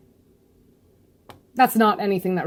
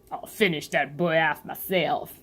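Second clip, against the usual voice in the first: menacing villain voice; 3 semitones lower, 4 dB quieter than usual. Acted. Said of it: "this one's kinda fun".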